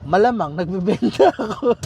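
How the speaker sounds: amused, laughing through the words. Real, not acted. Car seller joking about his own sales motive, laughing. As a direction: let a real laugh break into the line. Jokes that he sells both cars.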